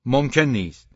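stern, forceful no; firm refusal. A narrator's reading. Angry father refuses, walks out.

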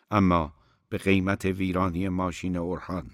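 usual speaking voice

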